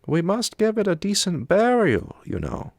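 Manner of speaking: the Swede deciding on a burial; the Swede, matter-of-fact. A narrator's reading. Swede voiced by reader.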